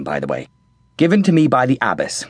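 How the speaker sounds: plain reading voice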